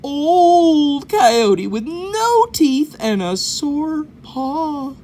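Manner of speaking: put-on high, slow, feeble old coyote voice; weak old coyote. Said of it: "pretended to be a very weak, old coyote".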